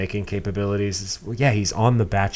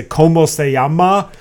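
mock Spanish; 7 semitones higher, 9 dB louder than usual. Mocking a contestant's Spanish.

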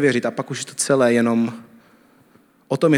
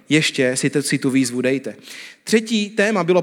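ordinary talk, nothing marked

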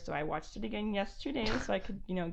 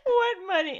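loud laughing retort; 12 semitones higher, 11 dB louder than usual. Real, not acted.

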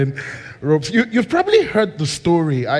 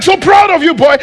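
high, loud beaming praise; proud praise; 12 semitones higher, 8 dB louder than usual. Acted. King praising the winning swimmer, high and loud. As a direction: burst out with pride.

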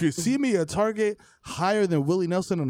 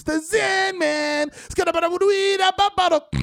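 high, slow hype shout; 12 semitones higher, 3 dB louder than usual. Pretend. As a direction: announce yourself like a hype man. Hype announcement.

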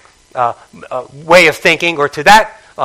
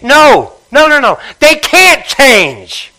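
high and loud, slowing at the end; emphatic correction; 6 semitones higher, 3 dB louder, slower than usual. Real, not acted. Preacher correcting his old view, loud and slow. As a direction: stretch the last words for weight.

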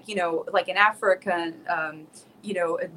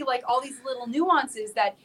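ordinary delivery

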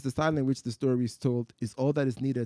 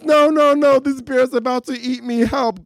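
high, loud terrified pleading; panicked scream; 12 semitones higher, 10 dB louder than usual. Acted. Atheist to God, screaming in terror. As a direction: scream as the bear looms. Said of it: "the atheist screams".

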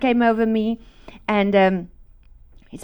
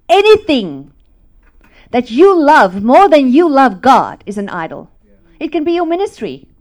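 loud, high fiery preaching; 7 semitones higher, 11 dB louder than usual. Acted. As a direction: drive it home from the pulpit. Preaching at full force.

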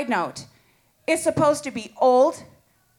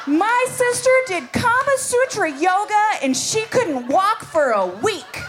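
higher, laughing through the words; laughing punchline; 6 semitones higher than usual. Retold or imitated. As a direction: let the laugh leak through the line. Sister blurts the punchline.

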